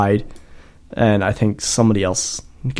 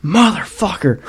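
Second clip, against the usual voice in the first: retold scream; 8 semitones higher than usual. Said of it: "And I'm screaming".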